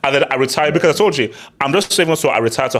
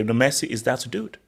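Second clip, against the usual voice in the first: ordinary talk, nothing marked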